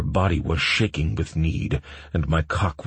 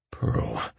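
whispered name, no voice; whispering; 8 semitones higher, 5 dB quieter than usual. A narrator's reading. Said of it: "I whispered".

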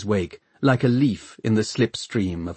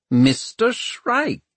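high, drawn-out greeting; slow sing-out in a raised voice; almost cheery; 13 semitones higher, slower than usual. A narrator's reading. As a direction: call out a cheery hello. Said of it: "sounding almost cheery".

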